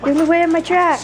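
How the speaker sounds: bossy puppy voice, lightly put on; voicing the dog. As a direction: speak for the pushy little dog. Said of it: "was like".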